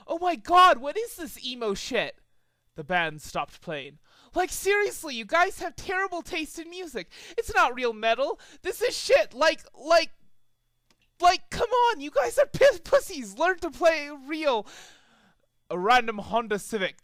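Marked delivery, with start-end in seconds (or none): 0.1-0.8 high, loud outburst; yelled. A narrator's reading. Twilight yelling at the band, loud and high. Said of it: "interrupted and yelled". 0.8-14.7 high, scornful rant; ranting Twilight. A narrator's reading. Twilight mocking the band's music, high and scornful.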